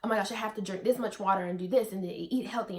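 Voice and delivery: rushed, on-edge rattling list; mock freaking out. Vlogger imitating worry over pregnancy rules, rushed and on edge. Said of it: "like, freaking out, like, just, like, on edge".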